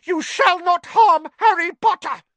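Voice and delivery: very high, loud protective cry; elf shouting. Acted. House elf defending Harry from Malfoy: loud shout. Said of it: "But Dobby shouted".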